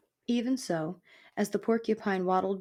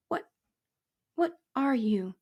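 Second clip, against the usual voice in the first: higher, faltering little voice; frightened mouse; 6 semitones higher than usual. Acted. Mouse dizzy with fear questioning porcupine, timid stammer.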